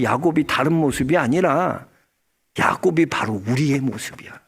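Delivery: ordinary delivery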